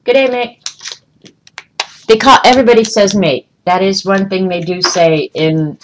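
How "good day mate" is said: Aussie imitation. Vlogger imitating Australians greeting her, a light higher Aussie lilt. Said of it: "I did hear people say, actually say".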